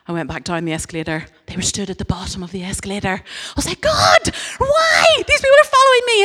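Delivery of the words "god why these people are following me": loud, very high crying shout; mock wail. Preacher reliving her cry to God, high mock wail. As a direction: wail it up to heaven in mock despair.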